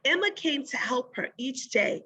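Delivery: ordinary delivery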